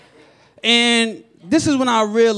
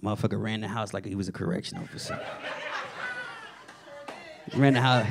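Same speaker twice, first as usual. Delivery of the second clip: usual speaking voice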